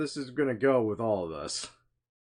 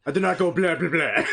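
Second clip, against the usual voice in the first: put-on laughing Transylvanian accent, louder; 5 semitones higher, 6 dB louder than usual. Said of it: "my accent".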